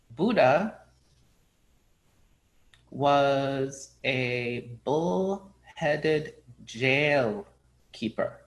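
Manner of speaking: drawn-out, deliberate Zen master verdict; very slow, solemn master's answer; slow pronouncement. Acted. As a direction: stretch each word like a cryptic koan.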